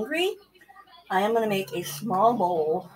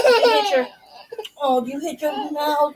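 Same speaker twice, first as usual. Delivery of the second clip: high alarmed concern; 5 semitones higher, 5 dB louder than usual. Real, not acted. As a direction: react to the bump with worry. Noticing the injury.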